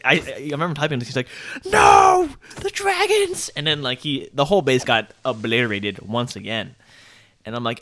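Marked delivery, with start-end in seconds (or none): 1.7-3.5 very high imitation wail of despair; mock anguished scream. Base owner watching the dragons attack, mock anguished scream. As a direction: scream it out, then stay high, hammed up.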